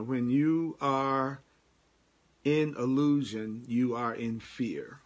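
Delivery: each word set down slowly; slow, deliberate teaching. Shown as a demonstration. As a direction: let every word land, unhurried. Slow emphatic teaching line.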